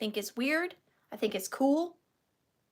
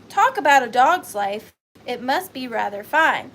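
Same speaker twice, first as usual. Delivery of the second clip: reading aloud; 8 dB louder than usual. Retold or imitated. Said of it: "and it says".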